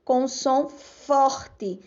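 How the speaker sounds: som forte stretched and emphasized; slow and stressed. Shown as a demonstration. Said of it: "quando o R for pronunciado". (som forte devagar e enfático)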